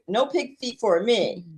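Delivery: usual speaking voice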